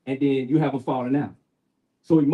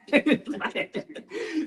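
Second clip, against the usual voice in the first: real laugh; 11 semitones higher, 4 dB quieter than usual. Real, not acted. Laughs after telling wife story.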